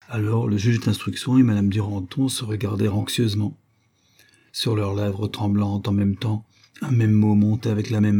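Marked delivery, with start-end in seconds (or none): none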